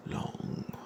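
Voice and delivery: ordinary delivery, nothing marked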